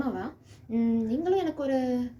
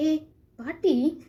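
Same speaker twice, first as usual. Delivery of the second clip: protesting call; 7 semitones higher, 7 dB louder, much slower than usual. Acted. Scolding grandma about last minutes.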